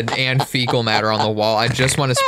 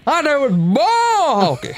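squeaky cartoon voice, very high; silly high voice; 14 semitones higher than usual. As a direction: ham it up in a goofy squeak. Goofy high put-on voice.

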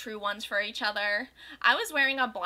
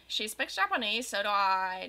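usual speaking voice

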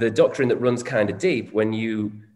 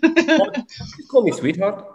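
higher-pitched imitation South African accent; 9 semitones higher than usual. Shown as a demonstration. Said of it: "Can I do the accent?".

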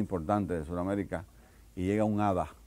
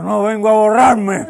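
put-on slow, loud, higher drunk voice; drunk punchline; 12 semitones higher, 14 dB louder, slower than usual. Drunk telling the AA board he quits, loud and slow. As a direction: slur it out like a proud drunk.

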